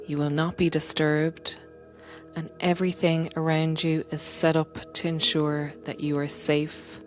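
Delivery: ordinary talk, nothing marked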